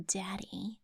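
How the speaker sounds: breathy whispered aside; whispering. Pretend. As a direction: whisper it like a creepy secret.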